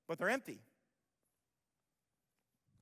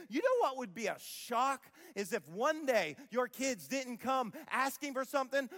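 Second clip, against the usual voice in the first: ordinary talk, nothing marked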